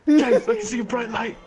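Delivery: high, loud mock-dying cry; dying plea. Dying patient calling to his friend, hammed-up distress.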